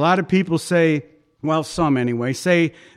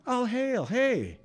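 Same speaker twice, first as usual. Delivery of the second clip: higher, soft and easygoing hail; casual greeting; 7 semitones higher, 6 dB quieter, slower than usual. Retold or imitated. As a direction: greet them like old friends, quietly. Jesus's casual greeting voiced.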